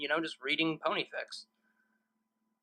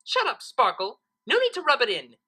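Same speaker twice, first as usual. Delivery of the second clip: yelled; 7 semitones higher, 6 dB louder than usual. A narrator's reading. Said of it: "yelled Trixie".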